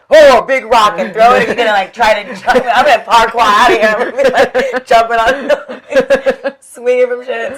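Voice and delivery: high, loud, laughing escape plan; giddy mock panic about jumping and swinging; excited parkour fantasy. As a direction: ham up leaping over rubble, laughing. Acting out earthquake escape.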